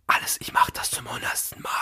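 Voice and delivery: very soft, voiceless pep talk; imitated whisper. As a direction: whisper it privately to psych yourself up. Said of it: "Genauso hat er geflüstert".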